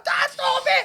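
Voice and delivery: high, loud pleading cry; kid's shout. Retold or imitated. Eight-year-old kids yelling at dad mid-punch, high and laughing. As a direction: shriek it like a scared kid.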